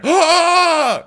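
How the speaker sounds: high, loud shocked gasp; mock group gasp. Everybody reacting to Garcelle, mock shocked gasp. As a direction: squeal like the whole room is shocked. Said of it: "the big shock".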